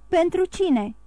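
a condemned man jolts up and yells it; shouted question. A narrator's reading. Condemned man cries out to the crowd, startled and loud. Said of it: "strigă el".

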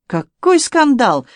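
high, slow, relishing exclamation; delighted. A narrator's reading. Onlooker enjoys the scandal of the duel, delighted. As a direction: savour it with drawn-out delight. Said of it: "с восторгом промолвил Робертен".

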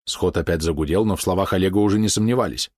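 usual speaking voice

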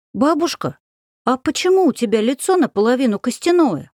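высокий детский голос с любопытством; спроси как любопытный мальчик; любопытный ребёнок. Acted. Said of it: "полюбопытствовал Матвей". (детский любопытный голосок)

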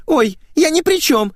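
put-on very high, flustered girlish voice; squealed protest. A narrator's reading. Girl defends herself to her friend, high squeal. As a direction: squeal your innocence. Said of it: "взвизгнула её собеседница".

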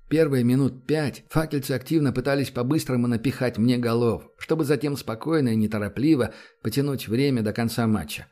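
plain reading voice

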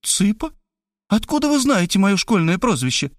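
high, puzzled question; woman surprised at her school nickname. A narrator's reading. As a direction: ask in disbelief. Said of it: "Мархалёва удивилась".

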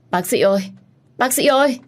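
high, loud cry for help; urgent call. Acted. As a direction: call the doctor in alarm. Calling urgently for the doctor.

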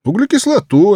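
звонко и выше, мальчишеский ответ; выкрикнуть ответ как школьник; ученик отвечает. A narrator's reading. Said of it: "отвечал я". (мальчик Петя отвечает гувернантке, бойко и высоко)

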